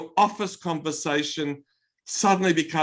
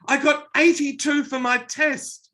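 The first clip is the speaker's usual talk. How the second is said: put-on excited child; 9 semitones higher, 4 dB louder than usual. Twelve-year-old lit up.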